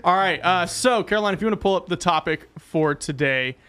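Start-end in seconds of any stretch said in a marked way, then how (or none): none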